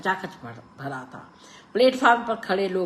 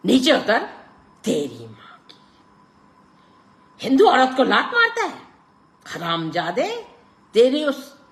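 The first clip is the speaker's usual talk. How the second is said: much higher, loud raging curses; furious shouting; 10 semitones higher, 11 dB louder than usual. A narrator's reading. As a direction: rage at him. Clerk's furious quarrel.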